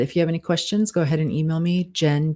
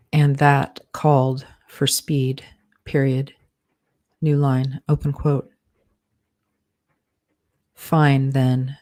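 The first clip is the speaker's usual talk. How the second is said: steady dictation with spoken punctuation; dictating; 2 semitones lower than usual. Acted. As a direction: read it out like dictating a draft. Author dictates novel with punctuation.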